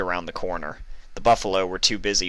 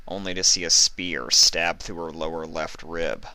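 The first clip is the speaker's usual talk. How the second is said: plain reading voice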